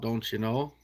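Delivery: light mock Minnesota accent. Showing chat how Minnesota people talk, Minnesota accent. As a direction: drop into a touch of Minnesotan. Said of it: "the Minnesota people talk".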